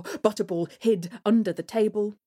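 ordinary delivery

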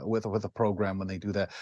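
ordinary talk

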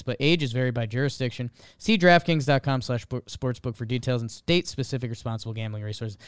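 rapid-fire fine print; fast legal disclaimer. Acted. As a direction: rattle off the small print.

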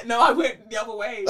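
laughing through the words. Real, not acted. Host laughs after a botched American accent, laughing. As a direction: giggle while protesting. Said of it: "but that's American?".

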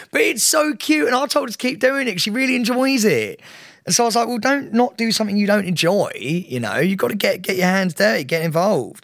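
high, gushing voice; fond, excited praise of her sister. Real, not acted. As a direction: gush with delight.

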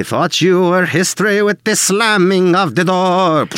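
put-on high, slow sung lyric with a French Canadian accent; mock French Canadian accent. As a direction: sing it like a hammed-up French Canadian diva. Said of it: "never noticed the accent on that".

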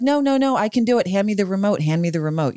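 imitating a stubborn friend, high then easing down; stubborn insistence. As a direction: refuse help, grab for the remote in mock. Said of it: "and you're like".

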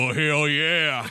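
slow drawn-out wrestler growl; hammed-up hell yeah, very slow; Stone Cold voice. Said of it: "You've got to do it".

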